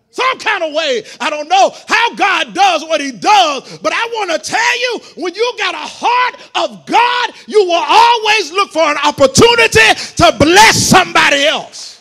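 loud, rising preacher's cry; preaching shout. Acted. Preacher warning the congregation, loud impassioned preaching. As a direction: bring it home to the church at full force.

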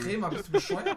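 high laughing outburst; laughing disbelief. Real, not acted. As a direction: laugh through the words. Laughing at teammate's mistake.

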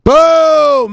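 very high, very loud shouted boom; screaming hype. Real, not acted. Host promising viewers' accounts will soar, screamed boom. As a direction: let it explode at the top of your voice.

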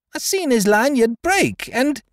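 high, shrill excuse, cut off; high-pitched desperate protest; crying out. Acted. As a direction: plead in a frantic high voice. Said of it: "cried out the man".

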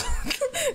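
high squealing laugh; hysterical laugh. Real, not acted. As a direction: burst out laughing helplessly. Laughing at movie scene.

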